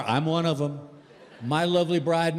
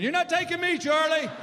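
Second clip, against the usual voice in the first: panicked scream; 13 semitones higher than usual. Retold or imitated. Patient refusing to let Charlie take him, panicked high scream. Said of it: "I remember screaming".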